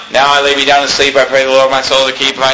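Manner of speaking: fast monotone bedtime prayer drone; rote prayer recital. Retold or imitated. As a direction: rattle it off as a mindless memorized prayer. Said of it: "this is the way a lot of people pray".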